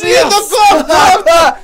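slow anguished yell; frustrated wail. Real, not acted. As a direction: cry out in disbelief. Losing frustration.

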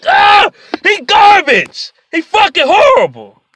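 high, loud, stretched shout; real frustrated yell at the end of your rope; exasperated. Real, not acted. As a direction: scream out your disgust. Frustrated climax of player rant.

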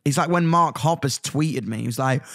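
ordinary delivery

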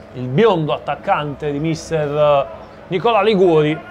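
ordinary delivery

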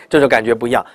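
ordinary talk

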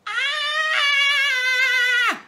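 short high excited squeal; sudden shriek of glee. Real, not acted. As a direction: squeal with delight at the piece.

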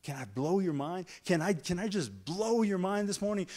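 usual speaking voice

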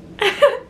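short high laugh. Real, not acted. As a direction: burst out laughing at your own mishap. Laughs after retelling the crash.

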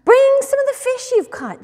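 high, loud call from the shore. A narrator's reading. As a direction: call it out to people at a distance. Jesus calls to fishermen from beach.

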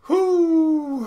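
loud high whoop; excited whoop. Real, not acted. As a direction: cheer it out. Cheering at game win.

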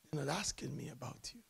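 a secret told in a whisper. Retold or imitated. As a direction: hush it down to a whisper. God's words, hushed.

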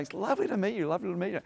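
overly sweet social greeting, masking the judging; fake politeness. Shaking a newcomer's hand while judging inside, mock sweetness.

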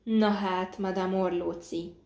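ordinary delivery, nothing marked

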